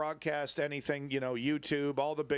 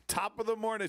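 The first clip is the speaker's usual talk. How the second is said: mock British greeting; 8 semitones higher than usual. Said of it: "incredibly cringy American top of the morning".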